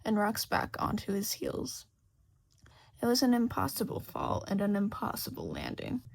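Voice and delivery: ordinary delivery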